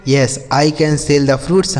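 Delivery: slow, careful English question; slow model reading. Shown as a demonstration. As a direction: read it out slowly for learners. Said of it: "slow model answer".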